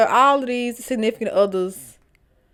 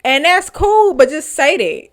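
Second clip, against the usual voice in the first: loud exasperated; 6 semitones higher, 6 dB louder than usual. Real, not acted. Scolding ex.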